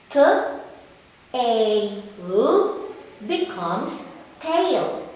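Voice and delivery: slow sounding out, then "tail" said whole; blending sounds. Shown as a demonstration. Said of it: "what part of the animal".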